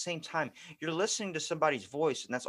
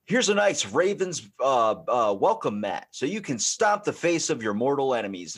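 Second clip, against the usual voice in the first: lightly voiced ad seller, loud; mock commercial pitch; 2 semitones higher, 7 dB louder than usual. Seller in football commercial offering Ravens mat, mock pitch. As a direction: pitch it loudly like a hammed-up TV ad.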